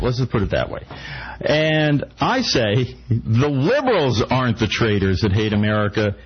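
usual speaking voice

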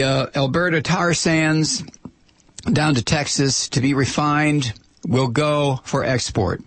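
ordinary talk

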